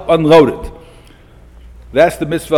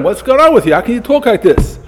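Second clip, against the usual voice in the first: higher-pitched mock scolding; retold rebuke, raise the pitch sharply; imitated yelling; 8 semitones higher than usual. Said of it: "he yelled at him".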